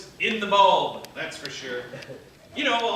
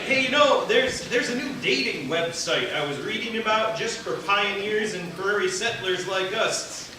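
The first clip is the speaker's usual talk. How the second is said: normal talking voice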